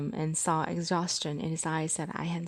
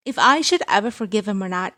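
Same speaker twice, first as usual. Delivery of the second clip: angry daughter; 3 semitones higher, 9 dB louder than usual. Acted.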